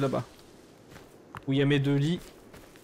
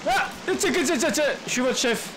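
mock chief; 10 semitones higher than usual. Mock-announces himself as chief.

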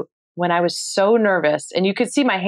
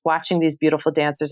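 ordinary talk, nothing marked

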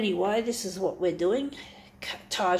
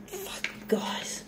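whispered, higher and much softer; 9 semitones higher, 11 dB quieter than usual. Real, not acted. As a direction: whisper it in dismay. Reacts to the machine's noise.

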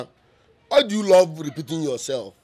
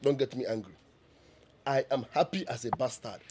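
softer, lower warning; 7 semitones lower, 10 dB quieter than usual. Acted. Son warning his mother quietly before he loses his temper. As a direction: hold the anger down tight.

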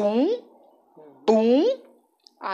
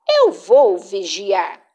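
ordinary delivery, nothing marked

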